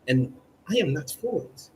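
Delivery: ordinary delivery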